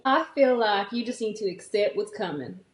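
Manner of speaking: country sweetheart standing firm; country accent. Pretend. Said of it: "The, the country one".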